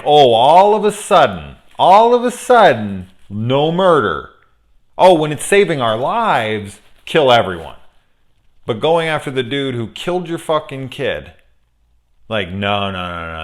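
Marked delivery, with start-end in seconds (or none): 0.0-7.8 loud, high mocking exclamations; sarcastic mock outrage. Mocking the survivors who suddenly object to murder, sarcastic outrage. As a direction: mimic their hypocrisy in an indignant, raised voice. Mocking the characters' double standard.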